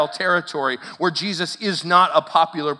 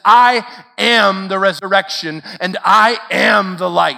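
loud proclamation; 2 semitones higher, 8 dB louder than usual. Retold or imitated. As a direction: proclaim it to the back row. Pastor repeats Jesus' words proclaiming.

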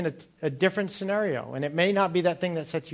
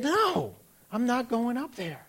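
much higher, stubborn refusal; higher teenage refusal; defiant teen; 8 semitones higher than usual. Retold or imitated.

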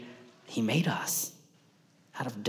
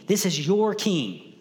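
"your" leaned on hard and drawn out slowly; slow, with the weight on "your"; stress on your; 5 semitones higher, 3 dB louder, slower than usual. Acted. Preacher reassuring the congregation, slowly pressing "your".

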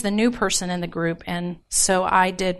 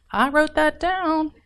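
a hint of a resentful parent, higher and slow; grudge-holding mother, lightly mocked; mock grudging parent; 7 semitones higher than usual. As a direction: lightly play the mom who never forgets.

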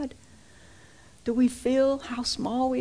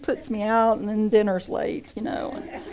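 ordinary delivery

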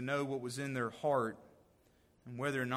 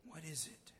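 much softer puzzled "What is it?"; hushed child's question; 2 semitones higher, 12 dB quieter than usual. Retold or imitated. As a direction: murmur it in childlike wonder. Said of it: "You remember that?".

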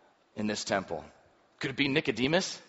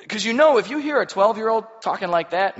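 excited; 9 semitones higher, 9 dB louder than usual. Real, not acted. Preacher marvels at the boy.

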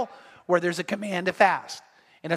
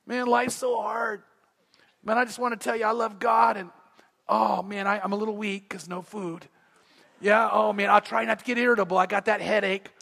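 whiny faster. Pretend. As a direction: whine like a self-pitying faster, hammed-up. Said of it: "walk around going".

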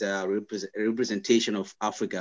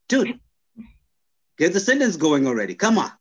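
higher-pitched exasperated urging; mock impatience; 7 semitones higher, 4 dB louder than usual. Returnee hurrying someone mid-sentence, mock impatient. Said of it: "I was the one that was no longer patient".

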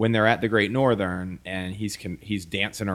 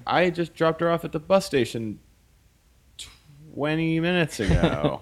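mock innocent Leland; 8 semitones higher than usual. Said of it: "he was like".

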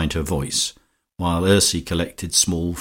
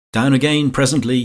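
high, lightly called-out line; calling out; 7 semitones higher, 4 dB louder than usual. A narrator's reading. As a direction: call it from the next room, pitched up. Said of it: "he called out".

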